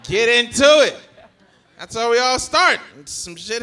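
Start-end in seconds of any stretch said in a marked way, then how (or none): 0.0-1.0 loud, laughing urging; laughing shout. Real, not acted. Comic urging a crowd member on, laughing and loud.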